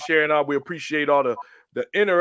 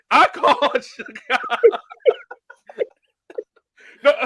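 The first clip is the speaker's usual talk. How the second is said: laughing; 12 semitones higher, 4 dB louder than usual. Real, not acted. Laughing through own quote.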